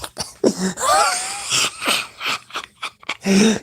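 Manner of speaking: high shrieking laughter; wild laugh. Real, not acted. Laughing at having to wake up to this life.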